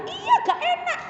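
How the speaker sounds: very high mock whine; retold insistence. Mother insists the food is bad, high mock insistence. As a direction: imitate the mother insisting, voice shot right up.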